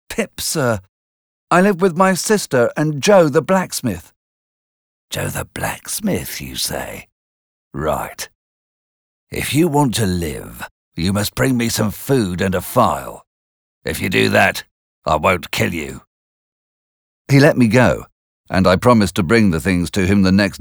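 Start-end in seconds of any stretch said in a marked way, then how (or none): 0.1-4.1 Pip answering nervously, lightly voiced; boy answering the convict; timid boy. A narrator's reading. 5.1-16.0 a lightly voiced rough prisoner making demands; menacing convict. A narrator's reading. Convict bargains with Pip.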